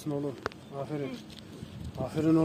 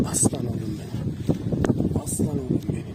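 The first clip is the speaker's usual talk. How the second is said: soft, low fond murmur; tender praise; 7 semitones lower, 3 dB quieter than usual. Real, not acted. Owner murmuring fondly to his dog.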